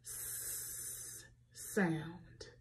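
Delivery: whispered hissing "S sound"; soft teacher's hiss on "S sound". Shown as a demonstration. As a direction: breathe out a long sss on "S sound". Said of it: "S makes the".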